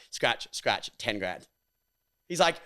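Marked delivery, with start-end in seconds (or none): none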